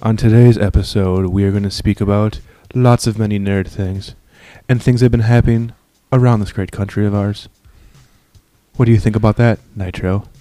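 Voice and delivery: low, monotone radio voice; deadpan public-radio parody; mock NPR host. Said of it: "go into N P R mode".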